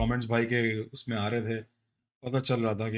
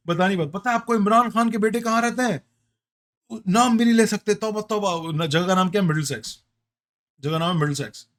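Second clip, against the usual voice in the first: high, fast, hammed-up scandal; excited mock outrage; 8 semitones higher, 5 dB louder, much faster than usual. Real, not acted. Co-host jokes about Middlesex, high, fast and mock-shocked. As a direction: play it up like it's a dirty word.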